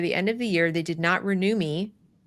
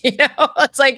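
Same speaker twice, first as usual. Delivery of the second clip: laughing; 4 semitones higher, 8 dB louder than usual. Real, not acted. Laughing at the absurdity.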